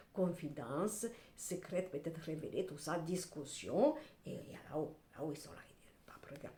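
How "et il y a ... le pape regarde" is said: whisper. Acted. Voicing the spirits above.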